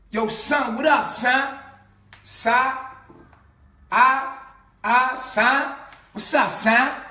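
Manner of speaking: high, loud New York greeting; shouted New York hail; New York accent. Retold or imitated. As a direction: call out in New York slang, vowels wide open. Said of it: "New York people talk like that".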